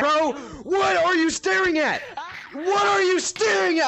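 rising to high, loud demand; confrontational yell. Real, not acted. Confronting a staring player, escalating yelling. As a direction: challenge them at full volume.

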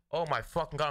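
usual speaking voice

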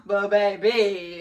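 ordinary talk

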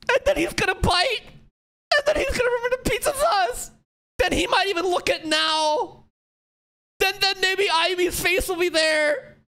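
very high, strained crying shouts; breaking down, voice cracking high; real tearful cry. Real, not acted. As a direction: cry out through tears. Streamer breaking down at scene.

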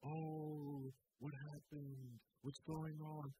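soft, quiet mock concern; hushed imitation of a bystander; mock concerned onlooker. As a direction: play the nosy sympathizer, softly. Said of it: "people are supposed to come and say".

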